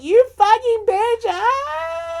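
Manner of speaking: high, loud wailing shout; hammed-up tantrum after being dismissed; mock outraged scream. Acts out outburst after mother leaves.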